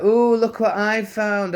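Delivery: high, slow mocking copper voice; young Garda. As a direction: ham up a smug young cop finding something. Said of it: "He's like".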